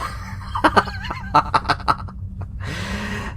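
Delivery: a big laugh rising high and long; hearty laugh. Acted. Admiral laughing at his own academy prank, hearty.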